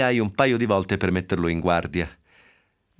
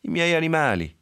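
whimpering; 8 semitones higher than usual. Acted. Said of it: "piagnuccolò Zoe".

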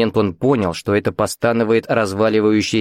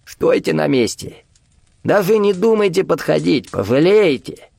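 tense, high-pitched threat; slightly calmer warning; 7 semitones higher than usual. A narrator's reading. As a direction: warn him off, holding the shout back. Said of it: "И тут же немного спокойнее".

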